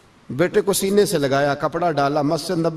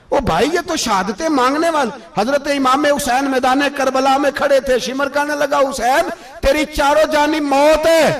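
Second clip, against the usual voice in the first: high-pitched, ringing sermon delivery; impassioned preaching; 8 semitones higher, 4 dB louder than usual. Acted. Preacher praising the devotees' lifelong prayer for martyrdom, impassioned. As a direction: preach it high and insistent to the congregation.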